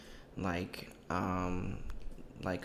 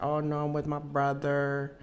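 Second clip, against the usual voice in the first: high, slow girlfriend impression; raised, dragging mock girlfriend voice; imitated ex; 4 semitones higher, slower than usual. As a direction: mimic the ex, drawing out the excuse. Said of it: "And she's like".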